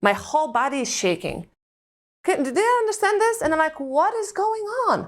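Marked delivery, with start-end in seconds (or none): 2.3-5.0 higher, faster, panicked questions; breathless alarm at the breakfast table. Retold or imitated. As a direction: retell the panic in a rush.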